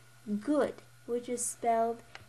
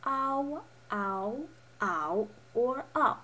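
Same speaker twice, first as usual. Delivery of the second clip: "ow" level, rising, dipping, then falling; four tones; slower than usual. Shown as a demonstration. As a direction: run "ow" through four tones: flat, up, dip, drop. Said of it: "A O can make".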